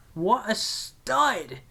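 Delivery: hammed-up admiration; mock awed voice. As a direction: drag out "What a stud!" in a silly voice. Repeated in a put-on voice.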